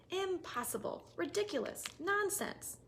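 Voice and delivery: high, dismissive voices; high mocking dismissal; scoffing sisters. A narrator's reading. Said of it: "they would say".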